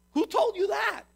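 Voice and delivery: high, loud, indignant question; incredulous challenge. Acted. God correcting Elijah who thinks he's alone, incredulous challenge. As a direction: challenge the claim with loud disbelief.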